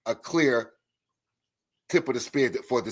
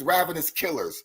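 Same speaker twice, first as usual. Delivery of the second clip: ordinary talk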